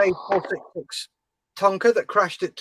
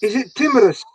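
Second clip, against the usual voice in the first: a hammed-up Scottish burr on timorous; teasing a mate in a bad Scottish accent; mock Scottish accent; 3 semitones higher, faster than usual. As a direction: repeat it, still the mock Scotsman. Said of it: "For such an awful accent".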